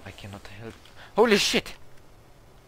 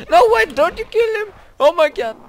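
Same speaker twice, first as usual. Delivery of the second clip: high, loud panicked cry; panicked yell; 11 dB louder than usual. Real, not acted. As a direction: yell in alarm. Panic continuing.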